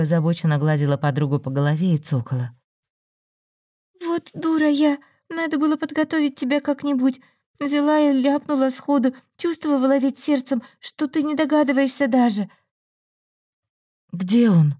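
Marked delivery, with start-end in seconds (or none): 4.0-12.5 higher, fretful friend scolding herself; lightly voiced anxious friend; Olga's worried voice. A narrator's reading. Olga voiced, self-reproach. 14.1-14.7 sudden urgent blurt, a bit louder. A narrator's reading. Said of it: "выпалила Люба".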